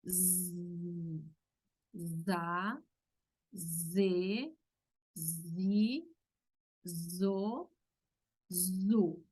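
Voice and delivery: za, ze, zi, zo, zu each with a voiced buzzing z; buzzed z syllables. Shown as a demonstration. Teacher drilling Portuguese z syllables, buzzed. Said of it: "este es el sonido de la letra Z".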